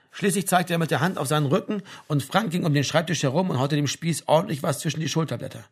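usual speaking voice

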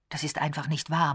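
plain reading voice

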